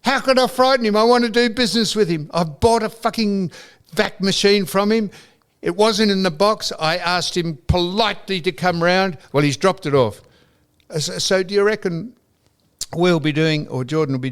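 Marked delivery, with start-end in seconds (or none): 0.0-9.2 indignant. Retold or imitated. Indignant retort to son.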